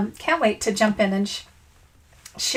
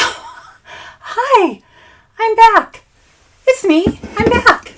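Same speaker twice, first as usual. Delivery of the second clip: excited; 9 semitones higher, 4 dB louder, much slower than usual. Real, not acted. Cheerful return after coughing fit.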